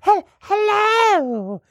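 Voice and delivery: high, drawn-out ghost hello; stretched, high ghostly greeting; spooky ghost. Shown as a demonstration. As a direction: call out like a wailing ghost.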